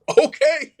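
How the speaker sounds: a pumped-up yes to the coach; squeaky excited agreement; eager okay. Retold or imitated. His eager reply to the coach.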